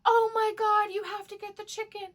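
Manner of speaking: high, loud delighted gush; very high thrilled cry; excited shopper. Retold or imitated. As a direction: fall in love with the chicken. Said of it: "this woman picked up the chicken and goes".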